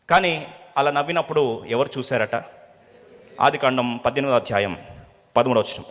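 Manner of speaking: ordinary talk, nothing marked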